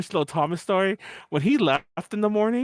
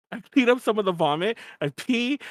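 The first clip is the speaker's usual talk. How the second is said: normal talking voice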